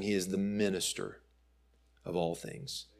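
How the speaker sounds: normal talking voice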